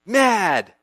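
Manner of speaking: normal talking voice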